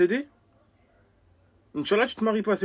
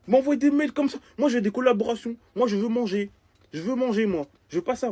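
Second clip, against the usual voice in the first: put-on high, loud childish complaint; whining protest; 9 semitones higher, 6 dB louder than usual. Man refusing unwanted emails, whining indignantly.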